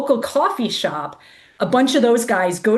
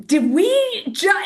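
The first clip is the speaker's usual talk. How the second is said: high, halting half-questions; retold stunned disbelief; 9 semitones higher, slower than usual. Runner checks with pacer what they saw, stunned and halting. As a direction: break off, higher, lost for words. Stunned after seeing naked stormtrooper.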